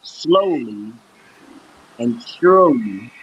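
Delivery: slowly, each word set apart; slow and deliberate. Shown as a demonstration. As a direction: space the words out with long pauses. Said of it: "is to practice".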